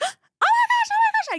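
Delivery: very high, loud squealing oh my gosh; fangirl shriek. Retold or imitated. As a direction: shriek it like a starstruck fan. Fangirl meeting crush at car.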